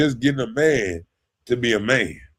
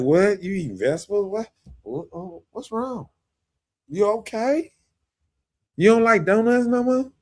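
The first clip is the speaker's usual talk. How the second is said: mock incredulous; 4 semitones higher than usual. Mocking how people react.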